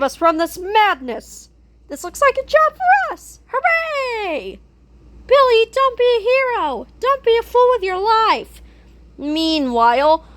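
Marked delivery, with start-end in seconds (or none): 1.9-4.6 excited cheer. Acted. Heroes cheer in high voices. 5.3-8.6 frantic plea. Acted.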